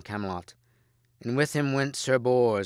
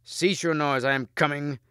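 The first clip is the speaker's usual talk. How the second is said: raised reply; 6 semitones higher, 4 dB louder than usual. A narrator's reading. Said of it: "replied Lancelot".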